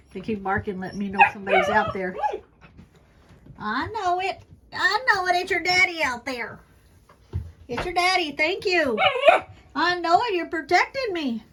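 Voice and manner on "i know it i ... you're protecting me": high, bright reassuring dog talk; talking to the dogs in a higher voice; raised pet voice. Real, not acted. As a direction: soothe the barking dogs up high. Soothing the barking dogs.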